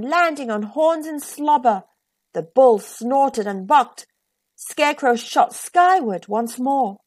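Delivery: usual speaking voice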